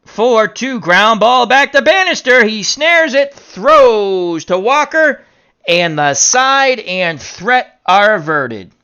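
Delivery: loud, high call with a long stretched "throws"; excited play-by-play. Pretend. Announcer calls the inning-ending ground out, excited play-by-play. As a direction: build on the fielding play, then slow to wrap it up.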